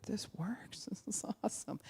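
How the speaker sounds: high whispered delight with a laugh; stoned whisper. Retold or imitated. Stoned person whispering happily about pot. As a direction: breathe it like a blissed-out stoner.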